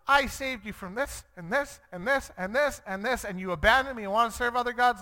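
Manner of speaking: high-pitched, piling-up list of grievances; exasperated God. Retold or imitated. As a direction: rattle it off like a fed-up parent. God voiced like exasperated parent.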